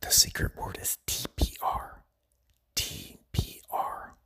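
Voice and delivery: hushed, fully whispered aside; secret whisper. Pretend. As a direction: whisper it as a secret tip to the listener. Shares a secret word with learners.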